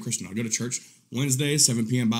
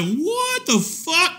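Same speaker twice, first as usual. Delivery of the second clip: high, slow, stretched out; shocked disbelief; much slower than usual. Retold or imitated. Watching the naked stomach video, shocked and slow. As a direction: drag the words out in horror.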